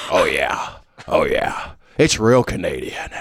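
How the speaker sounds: low, maniacal villain voice; Heath Ledger's Joker. Acted. Maniacal Joker confirms to the other, low. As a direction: answer from down low, as a crazed Joker. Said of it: "talking to Heath Ledger's Joker".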